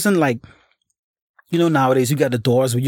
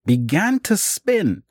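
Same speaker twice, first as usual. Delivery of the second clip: normal talking voice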